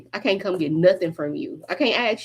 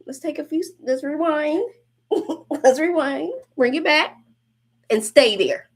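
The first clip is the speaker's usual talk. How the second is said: playful laughing; 7 semitones higher than usual. Real, not acted.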